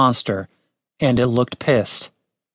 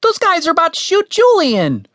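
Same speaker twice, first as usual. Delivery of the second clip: very high, alarmed warning; 3 dB louder than usual. A narrator's reading. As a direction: squeal the warning urgently. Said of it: "Why was his voice so high?".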